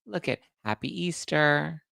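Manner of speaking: a light parody of a posing star; mock cooing celebrity. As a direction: coo at the camera like a posed holiday photo. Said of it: "they're like".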